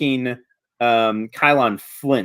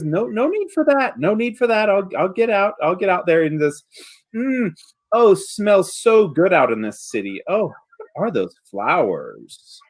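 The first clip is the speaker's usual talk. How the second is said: placating creature, then savoring; 6 semitones higher than usual. Acted. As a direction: back off politely, then drift into savoring. Creature backing off.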